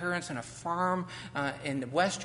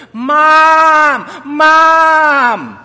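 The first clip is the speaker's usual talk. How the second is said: desperate high shout for mom; scared child calling mom; 12 semitones higher, 22 dB louder than usual. Acted. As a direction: scream for your mother in panic. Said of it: "I cried for my mother".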